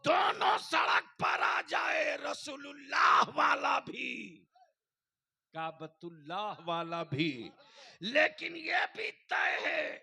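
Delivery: very high, shouted rallying cry; rally the crowd at the top of your voice; full-force preaching. Real, not acted. (जोशीली, ललकारती ऊँची तक़रीर)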